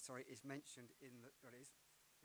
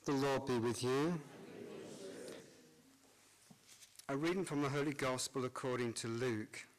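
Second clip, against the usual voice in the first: cathedral echo; 19 dB louder than usual. Acted. Priest proclaims Gospel in church.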